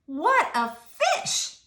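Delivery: slow, high and loud wonder; stunned at the size of the fish; amazed policeman. A narrator's reading. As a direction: draw it out in astonishment.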